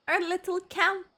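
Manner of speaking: normal talking voice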